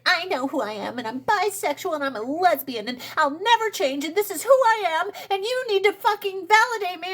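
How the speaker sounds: hammed-up teenage me demanding validation, building; whiny teen self. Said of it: "Because I was like".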